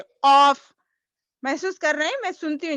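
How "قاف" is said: "قاف" much louder, booming q from the tongue root; deep qaf. Shown as a demonstration. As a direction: boom "قاف" from deep in the throat. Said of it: "قاف کی practice کیجیے".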